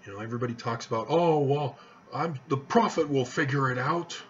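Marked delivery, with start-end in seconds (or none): none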